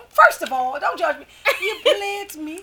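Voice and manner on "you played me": high, laughing fake British; mock British accent. Defending her British accent to co-hosts, high mock British. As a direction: deliver a hammed-up British line. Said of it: "This is my Brit-, British accent".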